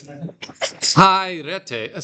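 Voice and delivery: "Kairete" drawn out slowly with a high KAI; exaggerated first-syllable stress. Shown as a demonstration. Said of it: "The accent is on the first one".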